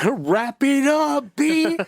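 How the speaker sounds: very high, slow, loud echo; drawn-out jokey holler through laughter; mock heckling.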